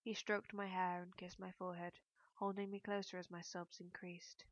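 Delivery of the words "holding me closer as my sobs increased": usual speaking voice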